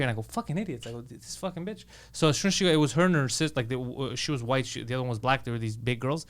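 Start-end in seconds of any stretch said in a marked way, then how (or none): 0.2-1.8 muttered. Retold or imitated. Muttering insult under breath.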